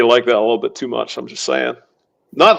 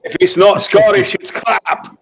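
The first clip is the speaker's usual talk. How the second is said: mock Scottish; 5 semitones higher than usual. Mike Myers Scottish sketch.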